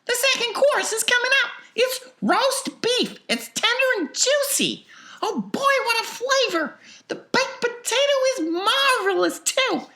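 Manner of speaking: put-on very high, loud excited child; thrilled girl. A narrator's reading. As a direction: rave about the meal, pitched very high. Violet raves over each course.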